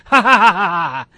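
forced mocking laugh; ha ha it out like a bad cover-up; fake laugh. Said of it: "I was just kidding".

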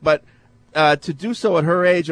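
ordinary talk, nothing marked

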